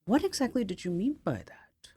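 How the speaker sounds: soft, musing whisper from an existentialist; a hushed, probing existentialist Siri. Retold or imitated. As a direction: whisper it like a brooding philosopher. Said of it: "the existentialism Siri".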